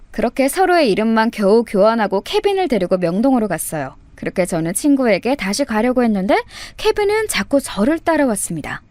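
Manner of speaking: usual speaking voice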